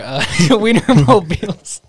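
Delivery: real laugh breaking into the words; laughing through it. Real, not acted. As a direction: try to get a tricky name out without laughing. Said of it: "trying to say Wienermobile without laughing".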